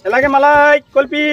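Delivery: high-pitched hawker's call; street vendor's cry. Acted. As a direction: call out your wares to the street. Acting a street ice-cream seller.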